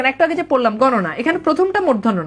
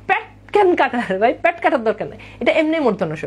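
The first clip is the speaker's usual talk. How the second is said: ordinary talk, nothing marked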